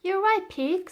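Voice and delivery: put-on high, bright and loud little girl; cheerful girl's voice, pitched well up; high excited girl voice; Florentine's voice. A narrator's reading. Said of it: "said Florentine".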